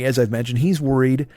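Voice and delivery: ordinary talk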